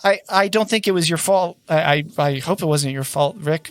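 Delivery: high, hesitant, stammering worry; flustered and apologetic, pitched well up; flustered woman character. Acted. Flustered woman checks on hurt Nico, high and anxious. Game master plays a character.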